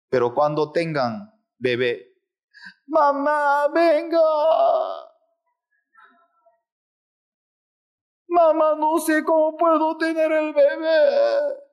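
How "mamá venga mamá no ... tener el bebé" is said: high, crying plea to mother; panicked daughter. Acted. Daughter in labor calling her mother, high crying plea. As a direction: wail for mama, tearful and high.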